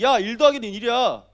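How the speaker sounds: loud mock scolding; accusing boss. Boss pointing at employee over a sum, accusing. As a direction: impose the wrong answer loudly. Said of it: "손가락질하면서 심지어 사장님까지".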